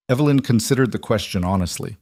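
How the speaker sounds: ordinary delivery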